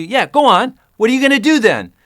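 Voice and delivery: retold director losing his temper; sarcastic angry director. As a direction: throw it back at him in a furious sneer. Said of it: "And he got so angry".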